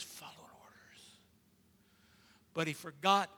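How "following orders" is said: soft whispered words; whispering. Real, not acted. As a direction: drop to a whisper for effect. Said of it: "whispered line in sermon".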